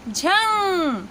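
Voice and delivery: झंग stretched very slowly, nasal ng held; slow teaching demo. Shown as a demonstration. Teacher showing students how to say झंग, very slow. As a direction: draw out झंग for learners. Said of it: "तो उच्चारण होगा".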